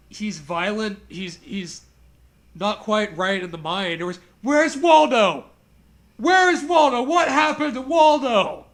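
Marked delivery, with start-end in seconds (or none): 4.4-8.6 loud, slow, high calling out for Waldo; hammed-up cry, where is Waldo; mock dramatic call. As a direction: bellow it like a frantic search party. Mocking book's cry for Waldo.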